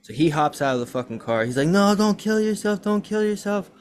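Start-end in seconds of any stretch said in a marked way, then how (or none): 1.7-3.7 much higher and louder frantic plea; panicked pleading. Retold or imitated. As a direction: beg him not to pull the trigger. Retold friend pleading.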